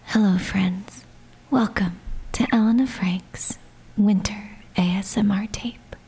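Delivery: ASMR whisper. Acted. Opens an ASMR tape.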